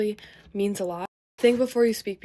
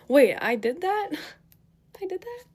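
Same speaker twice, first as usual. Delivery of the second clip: incredulous question rising high into a laugh; mock disbelief; 9 semitones higher than usual. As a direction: repeat it higher, laughing in disbelief. Acting out disbelief at rumors about her.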